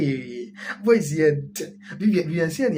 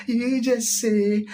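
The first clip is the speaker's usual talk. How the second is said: slow, drawn-out officiant cue; mock pastor prompt; 7 semitones higher, much slower than usual. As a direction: drawl it like a pastor leading vows. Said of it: "You're so for Bekabia".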